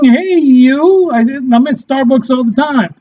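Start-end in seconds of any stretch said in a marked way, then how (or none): 0.0-1.1 high mock call to someone. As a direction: call out to a stranger in a coffee shop. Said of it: "I hear people saying".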